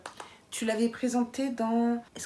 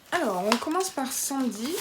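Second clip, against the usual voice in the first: ordinary talk, nothing marked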